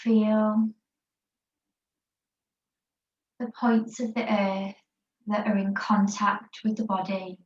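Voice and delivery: calm, unhurried yoga teacher voice guiding a rest pose; guided meditation. Acted. Yoga teacher guiding the class in child's pose, guided meditation voice. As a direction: ease the class into stillness, word by word.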